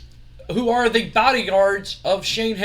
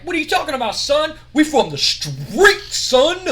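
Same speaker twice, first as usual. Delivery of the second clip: much higher, louder mock street talk; mock street swagger; 8 semitones higher, 6 dB louder than usual. Too Cool answer Steiner.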